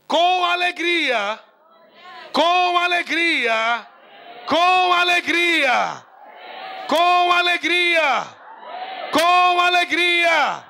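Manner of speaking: rising, slow, shouted refrain; slow and loud, building on each repeat; shouting with joy. Real, not acted. (gritando com alegria, cada vez mais forte)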